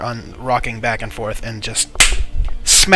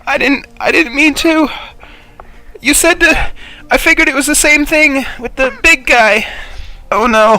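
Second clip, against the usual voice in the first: high, loud frantic defence; panicked excuse; 14 semitones higher, 7 dB louder than usual. Acted. Aris excusing herself to the party after hitting too hard, frantic. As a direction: protest in a squeaky panic.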